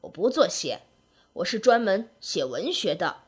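put-on low, grumpy old writer; disgruntled, pitched well down; thoroughly displeased. A narrator's reading. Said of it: "满肚子不高兴地说".

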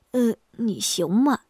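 hesitate, not quite trusting the speaker's wife; 不放心. Acted. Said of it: "张玉通不太放心地问". (有点不放心地轻声问)

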